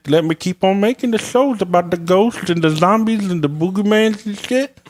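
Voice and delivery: very high dopey yokel voice. Pretend. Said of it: "he sounded like an Earl".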